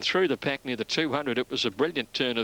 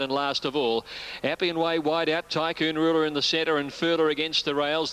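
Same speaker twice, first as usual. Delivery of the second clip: fast live race call building to the finish; race caller; 4 semitones higher than usual. Real, not acted.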